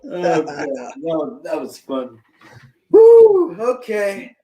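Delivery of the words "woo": loud high whoop; high woo. Real, not acted. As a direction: let out a whoop. Release after laughing.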